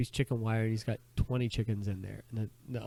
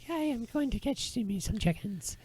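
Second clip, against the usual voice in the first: high, slow, put-on boy voice; joke boy voice; 11 semitones higher than usual. Boy answering his uncle by the river, high boyish voice. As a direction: pitch way up as the cartoonish lad. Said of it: "The boy's like".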